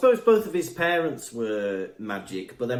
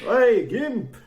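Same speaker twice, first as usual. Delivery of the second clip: a loud, higher call out, half laughing; mock street heckle; 9 semitones higher, 6 dB louder than usual. Imagining being called out in the street, loud mock heckle. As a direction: shout it like a passing stranger.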